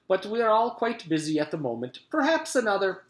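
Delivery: high, hurried Scottish politeness; pleasant Scottish gentleman. A narrator's reading. Mister Gorf puts off the cook, as polite Scotsman. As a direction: fob her off as a Scottish gentleman. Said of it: "pleasant voice he took from the Scottish gentleman".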